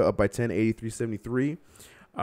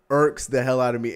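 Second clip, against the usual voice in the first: normal talking voice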